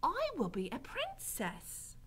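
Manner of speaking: high, slow wishful voice; Mig. A narrator's reading. Mig confiding her deepest wish, high slow voice. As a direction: declare it slowly, in a high character voice. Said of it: "moved suddenly to tell this man her deepest wish".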